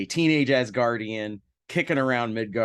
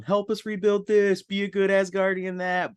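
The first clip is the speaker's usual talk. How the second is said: mock elders' plea; 8 semitones higher than usual. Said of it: "they want me to come home".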